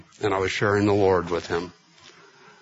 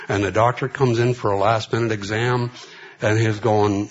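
ordinary talk, nothing marked

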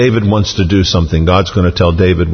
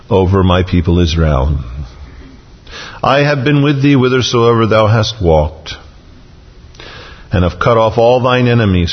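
measured, solemn Bible reading; formal recitation of God's message; scripture reading. Acted. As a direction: read each phrase deliberately, pausing after it. Reads scripture aloud.

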